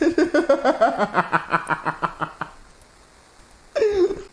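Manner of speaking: high, loud cackling laugh; losing it at the line; real high cackle. Real, not acted. Laughs at father blame line.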